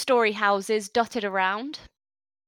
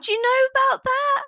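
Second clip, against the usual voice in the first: put-on high, loud child's demand; a child suddenly accusing the strangers; accusing orc child shout; 12 semitones higher, 3 dB louder than usual. Orc child demanding whether the players know about her cousin's death, loud and high.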